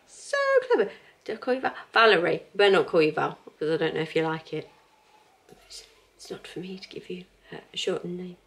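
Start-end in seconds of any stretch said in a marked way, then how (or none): 0.1-0.9 gushing, much higher praise; delighted. Real, not acted. Praising the pattern. 5.7-8.4 soft whisper to herself; whispered aside. Real, not acted. Aside about a nickname.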